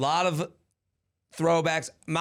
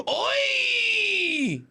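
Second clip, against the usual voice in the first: shout. Acted. Santa's loud call in poem.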